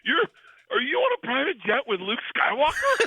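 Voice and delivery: high-pitched, astonished question; incredulous. Retold or imitated. Comedian friend reacting to a FaceTime from a private jet, incredulous. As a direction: blurt it out in disbelief.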